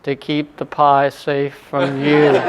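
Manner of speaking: low, slow deadpan reply; monotone docent. Retold or imitated. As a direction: answer in the same droning guide voice. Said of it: "the docent in the very".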